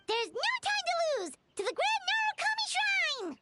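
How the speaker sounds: high, eager call to set off; rally everyone to rush out; pumped-up battle cry to go; excited rallying cry. Acted. Rallying the group to leave.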